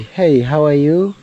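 ordinary talk

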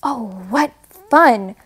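ordinary delivery

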